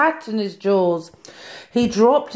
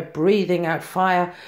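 plain reading voice

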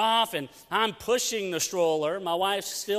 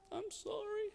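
much higher and soft, a mock tiny weepy voice; small tearful apology; 13 semitones higher, 14 dB quieter than usual. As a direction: imitate the wife's meek sorry.

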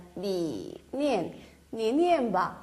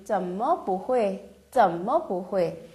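each syllable spelled out slowly; slow model reading; 3 semitones lower, much slower than usual. Shown as a demonstration. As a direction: read it slowly for learners. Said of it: "이렇게 표현이 된다". (천천히 또박또박 읽는 예문)

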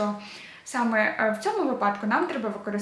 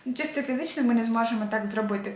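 usual speaking voice

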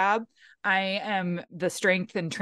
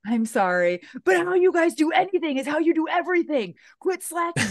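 raised, higher coach voice barking at the team; mock drill-sergeant push, pitched up; coach yell; 8 semitones higher, 3 dB louder than usual. Mom coaching the volleyball team, raised stern coach voice. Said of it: "I'm just always like".

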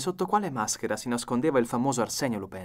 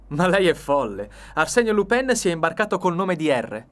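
higher, louder outraged denial; indignant protest; 6 semitones higher, 8 dB louder than usual. A narrator's reading. Accused man protesting to the detective, indignant. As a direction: protest loudly against arrest.

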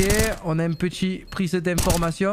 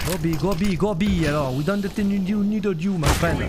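put-on English with a thick French accent, team captain. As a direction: play the French gamer pushing his English. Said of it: "avec cet accent". (anglais avec un fort accent français)

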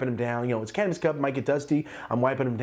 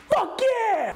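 high, loud and drawn-out hype, slowed; hammed-up excited yeah to the fans; fake enthusiasm; 3 dB louder than usual. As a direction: pump the fans up with exaggerated cheer. Back to the fans.

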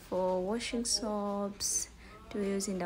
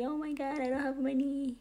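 high-pitched pretend panic of a broke shopper; higher, playful whine of being caught short; mock dismay; 5 semitones higher than usual. Hungry shopper imagining they have no money, in mock dismay. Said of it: "you be".

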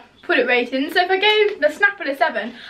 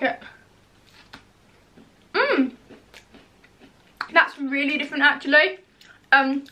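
chewing while talking; speaking through a mouthful; mouth full of candy. Real, not acted. Seen 3: Bites bar at -2, closed-lip chewing, then speaks with food in mouth.

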